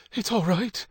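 high-pitched gasped words; gasped reassurance. A narrator's reading. Kate stopping him turning on the torch, gasping. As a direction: reassure through a gasp, pitch lifted. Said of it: "she gasped".

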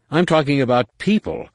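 heated insistence. Acted. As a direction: snap back, insisting. Jason insists in argument.

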